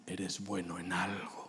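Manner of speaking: breathy whispered blessing; soft whispered "Eres bueno en algo". Acted. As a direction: whisper it into the son's ear.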